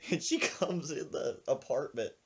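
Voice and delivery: ordinary delivery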